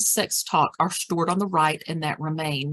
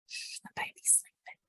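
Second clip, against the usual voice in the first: very soft whispered shush; hushing whisper; 18 dB quieter than usual. Shown as a demonstration. Caregiver calming a yelling resident, hushed whisper. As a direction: whisper so a sleeping baby won't wake. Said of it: "the baby sleeping".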